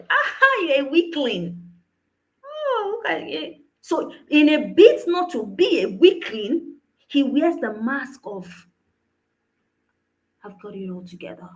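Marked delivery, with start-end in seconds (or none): 0.1-3.6 high-pitched teasing opener with a laugh; mock jeering. Voicing friends jeering at teenager. 10.4-11.5 very soft, low and quick; hushed, brushing-it-off self-assurance; acted composure. Voicing the composed mask.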